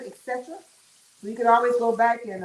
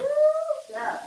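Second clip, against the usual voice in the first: startled woo. Real, not acted. Burning fish while live streaming.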